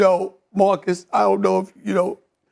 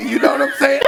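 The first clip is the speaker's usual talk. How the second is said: ordinary talk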